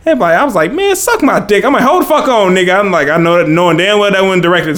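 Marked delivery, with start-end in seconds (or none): 0.7-1.6 high New York accent imitation; pitched-up New York accent. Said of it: "he said it with a certain type of accent". 1.8-2.8 pitched-up offended outburst; mock outrage. Retold offended reaction.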